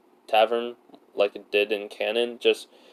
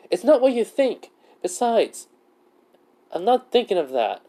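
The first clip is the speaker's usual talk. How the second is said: raised, higher-pitched mock denial; defensive protest; 11 semitones higher, 4 dB louder than usual. Acted flustered denial in dialogue.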